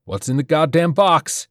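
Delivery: furious demand on rifle sights; tense shout. A narrator's reading. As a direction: shout it at the doctor, losing patience. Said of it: "His breathing was tense".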